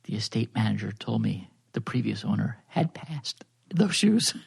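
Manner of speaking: sultry storyteller voice. Acted. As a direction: read it like a bedtime story for grown-ups. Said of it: "Dipsy voice model to turn you off".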